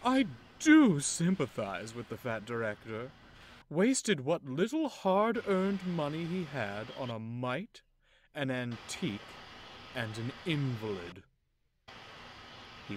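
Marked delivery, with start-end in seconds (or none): 0.0-11.2 louder, sneering boast meant to be overheard; loud mockery. Loaned engine mocking Henry loudly to another engine. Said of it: "said loudly".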